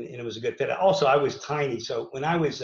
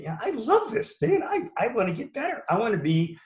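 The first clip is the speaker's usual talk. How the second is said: higher-pitched, eager declaration; recalling a thrilled self-promise, pitch lifted; retold fired-up vow; 9 semitones higher than usual. Wrestler recalling his vow leaving the gym, fired up.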